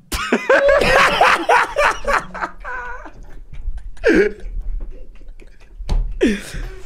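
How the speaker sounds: very high real laughing fit; long shrieking laugh. Real, not acted. Friends laughing at the sad part, long high laughter. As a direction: laugh helplessly and keep going. Group cracks up at victim.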